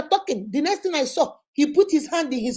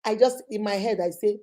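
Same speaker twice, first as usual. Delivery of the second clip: ordinary talk, nothing marked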